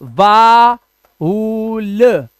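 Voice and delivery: वाउल split very slowly into vaaa, uulll; slow-motion VAA... OOL; drawn-out pronunciation. Shown as a demonstration. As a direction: stretch each half of वाउल with a pause between. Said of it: "Remember this".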